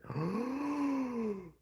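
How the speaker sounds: sharp, high gasp, strangely voiced; a sudden theatrical gasp of surprise; dramatic gasp. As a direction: suck in air in mock shock. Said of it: "I don't remember why I made that noise".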